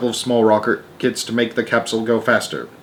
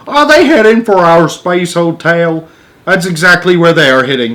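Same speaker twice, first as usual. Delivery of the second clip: alarmed; 6 semitones higher, 7 dB louder than usual. Acted. Radio exchange, rising alarm.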